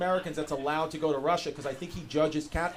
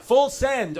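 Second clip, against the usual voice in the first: repeating loud; 6 semitones higher, 5 dB louder than usual. Real, not acted. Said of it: "excuse me?".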